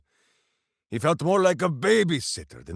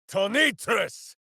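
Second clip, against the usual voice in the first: high, ringing battle incantation; spell shout; 7 semitones higher than usual. Acted. Minsk hurling a thunder spell in battle, shouting. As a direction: bellow the spell word to the heavens.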